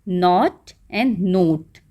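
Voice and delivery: नॉट short and open, नोट long o; short नॉट, then a long नोट; pronunciation contrast. Shown as a demonstration. Teacher contrasting knot and note slowly for pupils. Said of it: "इन दोनों की sound different है".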